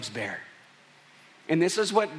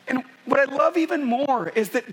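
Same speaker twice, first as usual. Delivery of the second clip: loving father; 6 semitones higher than usual. Retold or imitated. Father blessing son after bad day.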